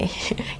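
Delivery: quiet, breathy little chuckle; soft self-mocking laugh; short laugh. Real, not acted. Teacher joking about her own driving, short soft laugh.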